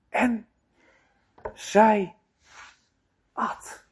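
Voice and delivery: slow, with long pauses, last word breathed; solemn pause before she ate; dramatic pauses. Acted. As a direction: let each word drop alone. Climax of the fall.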